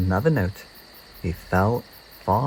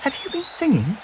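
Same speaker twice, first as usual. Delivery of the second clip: small bird voice. Acted. Peep voiced high.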